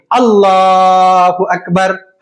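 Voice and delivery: slow, drawn-out takbir going into ruku; prayer takbir. Shown as a demonstration. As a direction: intone it like the imam. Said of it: "दूसरी रकात के लिए उठते हैं".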